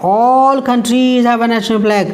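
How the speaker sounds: slow, careful dictation of the example sentence; slow read-aloud. Shown as a demonstration. Teacher reading the example sentence slowly to students. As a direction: read it out word by word for the class.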